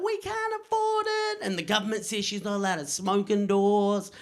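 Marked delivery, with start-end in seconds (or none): 0.0-1.4 high, slow, strained mum voice; high slow tired mother; mum's voice. Retold or imitated. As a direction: pitch up and drag it out like a worn-out mum. Mum answers crying son.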